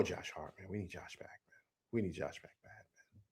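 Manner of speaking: low, very soft, deflated muttering; dejected. Real, not acted. Host lamenting a missing player after a loss, dejected and quiet. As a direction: let it sink almost to nothing.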